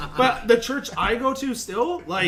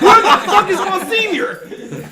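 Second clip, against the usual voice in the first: much higher, louder and slow, laughing; shocked outburst; 12 semitones higher, 11 dB louder, slower than usual. Retold or imitated. Telling friends he missed Monsignor: high, loud, slow, laughing outrage. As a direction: stretch out the outrage at the missing priest. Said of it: "and I went".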